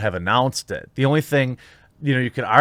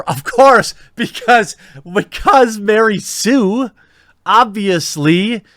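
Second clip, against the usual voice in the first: loud, high, sarcastic outburst; mock exasperation; 9 semitones higher, 6 dB louder, slower than usual. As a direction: exclaim it high and drawn-out, dripping with sarcasm. Mocking an overpowered heroine.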